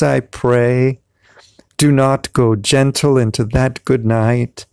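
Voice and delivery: slow, impassioned verse in a put-on British-Irish mixed accent; accented poem recitation. Reading Dylan Thomas in his gut-feel accent, impassioned poem recitation. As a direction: declaim it with long, stretched vowels in a mixed British accent. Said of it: "I give it an accent".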